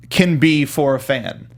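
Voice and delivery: usual speaking voice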